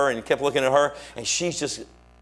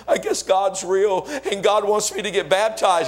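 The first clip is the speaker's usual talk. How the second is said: higher-pitched, mildly tearful retelling; retold bawling; 6 semitones higher than usual. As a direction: imitate someone bawling, voice raised in pitch. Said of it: "he just started bawling".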